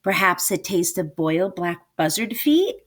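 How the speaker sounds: ordinary delivery, nothing marked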